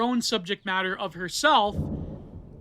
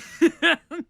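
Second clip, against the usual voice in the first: burst of laughter; 8 semitones higher, 6 dB louder than usual. Real, not acted. Laughs at his own joke.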